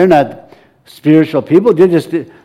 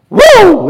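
high, loud excited whoop; excited woo; 6 dB louder than usual. Real, not acted. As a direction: whoop with delight. Excited exclamation.

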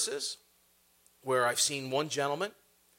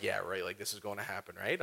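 quiet, sarcastic "yeah, right" under the breath; doubting scoffer, muttered low; sarcastic disbelief; 10 semitones lower, 10 dB quieter than usual. Retold or imitated. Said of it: "I'm like".

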